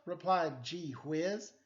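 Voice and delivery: ordinary delivery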